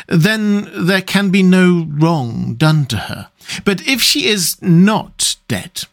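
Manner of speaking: careful, weighty reasoning. A narrator's reading. Doctor reasoning with Arthur over Lucy, deliberate. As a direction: lead up to it slowly, word by word.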